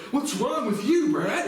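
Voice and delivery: high mocking whine; mocking them. Mocking strangers online asking what's wrong, high whine. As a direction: mimic their whiny question. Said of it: "They're like".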